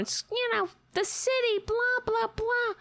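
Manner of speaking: high mocking imitation of a nagging woman; high sing-song mock of a busybody; mock nagging lady. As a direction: parody her complaint in a high voice. Mocking the lady's complaint.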